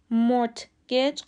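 مورتگیج with the T sounded, "mort-gij"; wrong pronunciation. Shown as a demonstration. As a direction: break مورتگیج after a hard T. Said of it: "غلطه".

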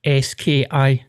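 slow letter-by-letter spelling. Shown as a demonstration. Spelling the name for his friend, slow and deliberate. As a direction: spell each letter slowly and clearly. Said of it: "No, no".

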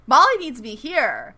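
incredulous, lightly hammed protest; indignant Mohinder. Mohinder protests being sent to the field, mildly indignant.

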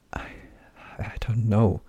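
frightened breathless whisper. Acted. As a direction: barely voice it, scared. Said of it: "Crow whispered".

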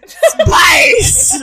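laughing loud shout. Acted. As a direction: yell it out with a laugh. Shouted punchline about space.

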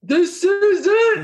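high, drawn-out mock singing; hammed-up men wailing along to karaoke; impression of men at karaoke. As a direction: belt it high and slow, laughing. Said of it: "my impression of men".

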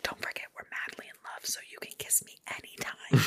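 hushed, intimate whisper. Acted. Injured boy to girl up close, whispered. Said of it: "he whispers, he's like".

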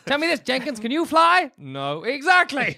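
high, blustering boss grilling an employee; gravelly old tycoon. Pretend. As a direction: act like a pompous old boss. Playing a boss questioning Jenkins.

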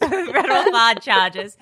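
Federal fraud charges in a New Jersey accent, laughing. Shown as a demonstration. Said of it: "In your New Jersey accent".

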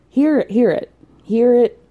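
loud, insistent, each "hear it" hammered; emphatic call. Real, not acted. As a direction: chant it at her until it lands. Urging Sierra to reflect.

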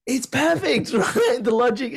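high, laughing through the words; delighted giggle over a joke. Real, not acted. Host laughing as she praises the method, high giggly voice.

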